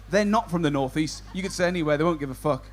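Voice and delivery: ordinary delivery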